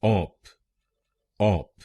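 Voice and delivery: ऑप said alone and clearly, short "op"; syllable demo. Shown as a demonstration. Teacher drilling the first syllable, said slowly and clearly. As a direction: say ऑप on its own, like a teacher. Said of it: "हम बोलेंगे".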